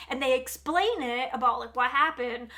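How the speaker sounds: ordinary talk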